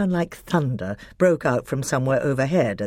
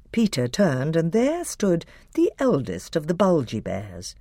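usual speaking voice

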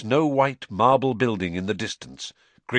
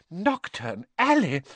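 higher, slow horrified exclamation; appalled; 10 semitones higher than usual. A narrator's reading. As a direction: let the name horrify you. Mrs Weasley appalled at the place.

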